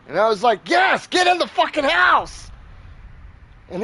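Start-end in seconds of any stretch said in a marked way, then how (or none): 0.7-2.5 high-pitched shouted order; retold urgent yell. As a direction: re-enact yelling someone to safety.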